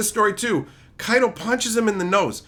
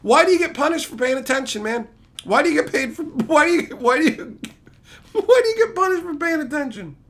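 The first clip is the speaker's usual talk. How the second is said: mock whiny lament; 8 semitones higher than usual. Mocking the characters' fate reaction, whiny lament. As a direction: whine like a wronged character. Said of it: "And it's like".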